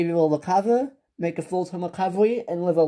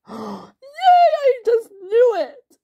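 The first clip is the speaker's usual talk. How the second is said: very high, slow squealing cry of joy; shrieking with happy tears; overjoyed squeal; 5 dB louder, much slower than usual. Acted. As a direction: squeal it out, laughing and tearful with joy. Pregnant wife hears it's a boy.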